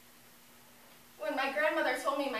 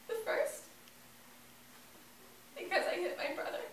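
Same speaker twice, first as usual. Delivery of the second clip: grief-stricken; 8 semitones higher than usual. Acted. Grieving child lists self-blame.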